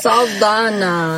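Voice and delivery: "Saldana" with a lightly Spanish, slow rolled sound; Spanish accent. Pretend. Asked by her friend to say the name, light Spanish accent. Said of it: "Try to say her name in the accent".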